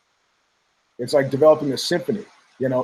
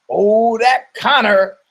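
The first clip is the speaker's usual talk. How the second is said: higher, louder, slow fond sigh of a catchphrase; sitcom catchphrase; 5 semitones higher, 4 dB louder, much slower than usual. Retold or imitated. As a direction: say it like a sitcom audience adoring the star. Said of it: "it's a new sitcom".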